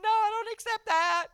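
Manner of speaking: high wailed refusal; crying. Retold or imitated. Rejecting the diagnosis, crying. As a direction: cry out in protest. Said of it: "I'm crying 'cause it's a horrible".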